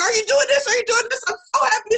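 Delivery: high, loud, bubbling over with joy; excited squeal. Retold or imitated. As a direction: gush like an overjoyed mom. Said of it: "she's like".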